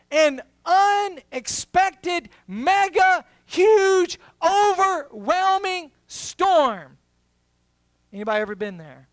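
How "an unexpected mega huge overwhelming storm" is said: loud, high, slow build word by word; full-force preacher build; preaching climax. Acted. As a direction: hammer each word higher and louder.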